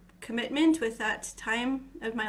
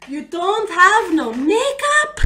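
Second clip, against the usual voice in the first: high, loud, slow shocked question; shocked disbelief; 7 semitones higher, 10 dB louder, slower than usual. Retold or imitated. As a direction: ham up the disbelief. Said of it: "everyone was like".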